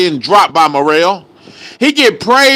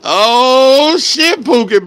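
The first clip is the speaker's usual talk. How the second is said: surprised; 4 semitones higher, much slower than usual. Real, not acted. Surprised reaction.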